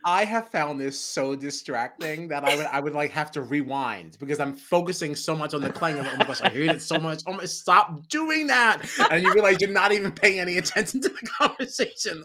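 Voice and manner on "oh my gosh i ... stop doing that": hammed-up annoyed plea; mock irritation. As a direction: whine at the screen to make it stop. Voices own irritation while watching.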